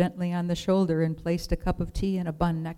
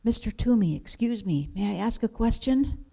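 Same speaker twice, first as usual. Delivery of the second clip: a hint of a small boy, higher and hushed; lightly voiced boy, hushed; 4 semitones higher than usual. A narrator's reading. Little Thomas timidly asks the woodcarver, hushed and higher. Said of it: "Thomas whispered".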